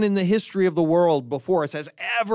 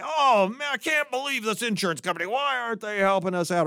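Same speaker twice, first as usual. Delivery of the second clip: mock complaint; 6 semitones higher than usual. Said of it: "Am I gonna say".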